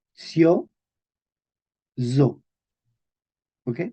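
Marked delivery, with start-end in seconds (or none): 0.1-2.4 sio as tsio, then a buzzed zo; syllable demonstration. Shown as a demonstration. Teacher building the word, syllable by syllable. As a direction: separate sio and zo with a pause. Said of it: "Entonces era vi".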